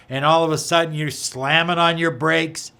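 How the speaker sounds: ordinary talk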